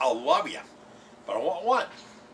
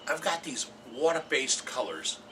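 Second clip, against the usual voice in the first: ordinary talk, nothing marked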